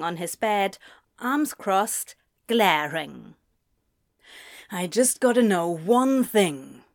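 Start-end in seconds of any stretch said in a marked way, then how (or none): 4.7-6.8 tense, clenched-jaw demand; controlled anger in character. A narrator's reading. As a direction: force the question through a tight jaw. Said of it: "he asked with his jaw clenched".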